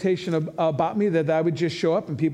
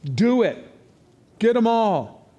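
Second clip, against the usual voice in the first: louder, taunting challenge; mocking dare; 4 semitones higher, 4 dB louder, slower than usual. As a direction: goad them on, louder. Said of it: "And I said".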